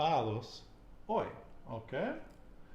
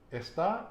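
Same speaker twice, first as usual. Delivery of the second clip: "está" higher, stressed es-TÁ; "está" with the last syllable raised; stress on final syllable; 7 semitones higher, 4 dB louder than usual. Shown as a demonstration. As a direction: punch the accented A of "está". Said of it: "E S T A with the accent".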